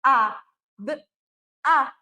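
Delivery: "A, d, a" spoken one at a time with pauses; segmenting the word fada for a child; sounding out phonemes. Shown as a demonstration. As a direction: break the word into single sounds. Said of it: "Ah, eu tenho quatro sons".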